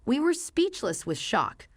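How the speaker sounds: ordinary delivery, nothing marked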